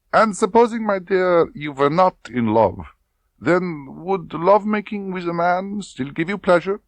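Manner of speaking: high, loud, probing; insinuating colonel. Acted. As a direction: lean in on the question. Klebb probes.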